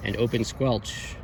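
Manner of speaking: ordinary talk, nothing marked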